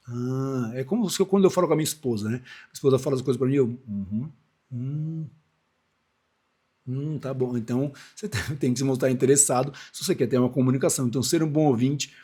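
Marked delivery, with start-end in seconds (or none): 3.9-7.6 quiet, noncommittal humming; half-listening murmurs, imitating an absent husband; uninterested listener. Husband half-listening while his wife tells him things, vague murmurs. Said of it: "com cara de paisagem".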